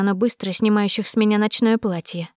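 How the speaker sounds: ordinary delivery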